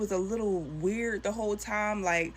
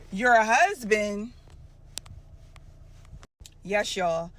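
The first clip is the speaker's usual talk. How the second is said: shocked disbelief; 5 dB louder, much slower than usual. Real, not acted. Shocked aside to viewers.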